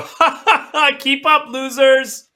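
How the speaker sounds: high, loud taunting laugh and call; Billy. Acted. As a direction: laugh and yell mockingly at those lagging behind. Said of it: "a distant echo of Billy's voice go".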